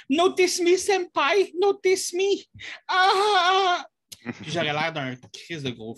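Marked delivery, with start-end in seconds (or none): 0.1-2.4 high, loud fangirl plea; excited anime fan. Pretend. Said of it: "Puis je serais comme". 2.9-3.9 high loud fangirl shriek; fangirl squeal. Pretend. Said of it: "Puis je serais comme".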